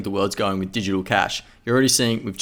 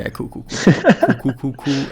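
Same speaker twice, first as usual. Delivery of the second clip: laughing; 5 semitones higher, 5 dB louder than usual. Real, not acted. Laughing repeated cool.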